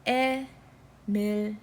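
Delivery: Emily in slow, separate syllable blocks; split into syllables. Shown as a demonstration. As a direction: say Emily one syllable at a time, drawn out. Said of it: "syllable blocks, as we can see".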